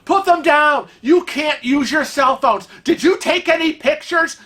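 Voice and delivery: hammed-up shouting of a power-tripping guard; retold furious screaming. Terminal woman screams at them to put phones down, furious shouting. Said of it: "she starts screaming at us".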